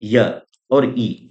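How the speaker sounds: य और ई drawn out very slowly; slow vowel demo. Shown as a demonstration. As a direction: stretch य and ई for the class. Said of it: "जैसे".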